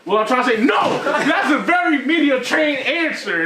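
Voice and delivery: high, slowing mock outrage; mock mad interruption. Retelling the heated interview exchange, mock mad. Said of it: "He's getting mad".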